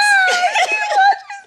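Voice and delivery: big high shrieking laugh; loud laugh. Real, not acted. Cracking up at her friend's vlog comment, loud shrieking laugh. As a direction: let a loud laugh burst out.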